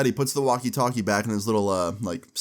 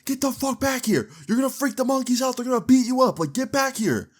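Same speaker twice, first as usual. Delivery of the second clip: screaming at a friend; 13 semitones higher than usual. Retold or imitated. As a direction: scream at him to come back out. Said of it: "we're screaming at him".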